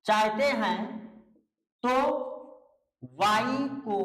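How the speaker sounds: word by word, with long pauses between chunks; teacher's dictation. Real, not acted. Teacher dictating a spelling rule to students, halting dictation delivery. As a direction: dictate slowly, repeating pieces as you go.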